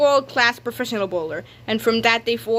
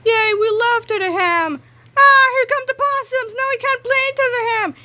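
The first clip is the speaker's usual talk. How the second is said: much higher squeals to panic; high excited kids; 12 semitones higher, 4 dB louder than usual. Acted. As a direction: squeal with glee, then shriek. Kids cheer then scream.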